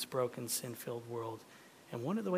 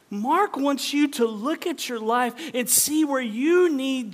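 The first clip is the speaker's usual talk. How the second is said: loud, high, urgent preaching; full-force preaching; 8 semitones higher, 10 dB louder than usual. Acted. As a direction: preach it hard to the whole room.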